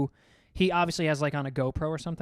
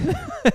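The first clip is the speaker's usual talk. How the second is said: high burst of real laughter; sudden high laugh; 6 dB louder than usual. Real, not acted. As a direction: crack up laughing. Laughing at own retold line.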